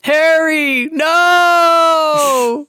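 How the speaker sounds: high, loud drawn-out cry; mock desperate shout. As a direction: call after Harry in hammed-up alarm. Mock cry to Harry in retelling.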